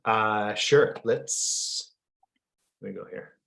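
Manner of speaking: speaking between coughs; coughing while talking; coughing fit. Real, not acted. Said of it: "I'm coughing here".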